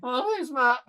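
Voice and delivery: very high, loud, squeaky starstruck hello; starstruck fan. Retold or imitated. Fan meeting his childhood idol at Comic Con, squeaky starstruck gushing. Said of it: "you can see I'm just like".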